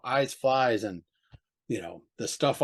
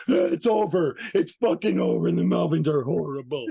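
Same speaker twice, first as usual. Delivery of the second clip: mocking whiny fan; 4 semitones higher than usual. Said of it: "rush online and go".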